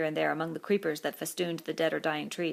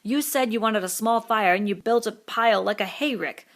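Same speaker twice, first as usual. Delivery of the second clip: bitter complaint; 4 semitones higher, 7 dB louder than usual. A narrator's reading. Piggy complaining.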